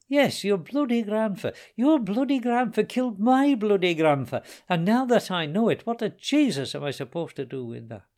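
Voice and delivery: high, loud bitter rant; angry outburst. Acted. O'Keefe raging at Timothy over grandfathers, angry. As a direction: spit out the grievance about the grandfathers.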